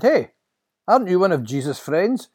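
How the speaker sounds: high, loud challenge; accusing passerby. Acted. Passerby stopping one of the disciples, accusing. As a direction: call out a suspect.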